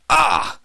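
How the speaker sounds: high, loud yelp of shock; shocked cry. A narrator's reading. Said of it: "crying out more in shock than pain".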